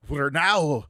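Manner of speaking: loud, slow attempt at a Scottish accent; bad Scottish accent, laughing. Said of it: "if I could do a Scottish accent".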